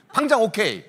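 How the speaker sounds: loud, emphatic comic okay; instant agreement. Retold or imitated. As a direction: blurt the okay loudly, imitating instant approval. 설득된 아내의 즉답 흉내. (단번에 승낙하듯 크게 외치는 흉내)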